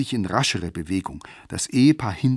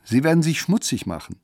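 high squeezed voice; 6 semitones higher, 3 dB louder than usual. Acted. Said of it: "mühsam mit seiner hohen, gequetschten Stimme".

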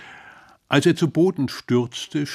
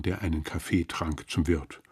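usual speaking voice

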